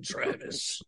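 breathy unvoiced whisper; whispered name. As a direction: whisper his name menacingly.

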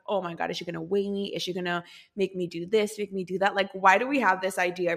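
normal talking voice

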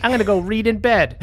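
put-on high, bratty teenage kid talking back; mouthy teen. Teen kid mouthing off to his mom, high and bratty.

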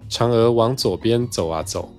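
usual speaking voice

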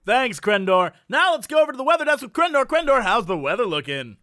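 loud, quick broadcast handoff; mock news anchor. Host hands over to the weather desk, mock anchor delivery. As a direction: project like a hammed-up newscaster.

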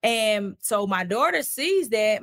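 usual speaking voice

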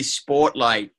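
put-on light Boston accent; Michael Keaton Boston accent. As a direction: say it like a Boston local. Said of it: "trying to do a Boston accent".

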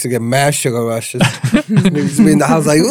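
ordinary delivery

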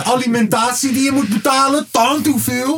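high-pitched playful exhortation; mock pleading. Fan urging [name] to release an album, high mock plea. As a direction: plead up high, as if calling to him.

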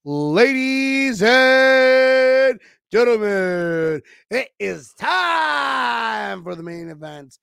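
high, slow, drawn-out ring call; boxing ring announcer. Acted. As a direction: stretch it like a title fight intro. Announcing the Bills matchup.

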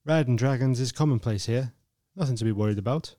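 low, soft calming voice; reassuring Theon. A narrator's reading. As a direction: soothe her fear.

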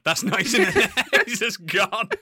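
laughing. Retold or imitated. Retelling with laughter.

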